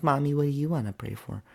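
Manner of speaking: a hint of a little girl asking; child voice. Daughter asking mommy at bedtime prayers, childlike. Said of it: "And she's like".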